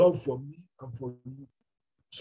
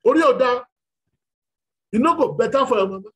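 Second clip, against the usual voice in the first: high, loud mocking rebuke of a stranger; angry Lagos bus stop retort; angry insult; 6 semitones higher, 7 dB louder, faster than usual. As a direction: snap at him in Yoruba-flavored pidgin. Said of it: "They say".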